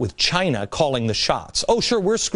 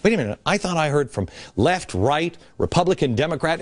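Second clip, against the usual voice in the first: usual speaking voice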